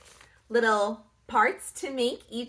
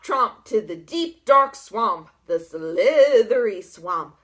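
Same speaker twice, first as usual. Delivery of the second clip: ordinary delivery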